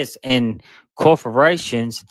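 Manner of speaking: usual speaking voice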